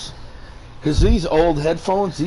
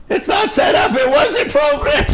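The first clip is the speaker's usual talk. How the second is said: loud mock shout; 13 semitones higher, 5 dB louder than usual. Mock protest about movies setup.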